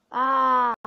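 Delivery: loud half-joking wail of frustration; whiny cry. Real, not acted. Gamer losing again lets out a loud mock wail. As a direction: groans loudly as if about to cry.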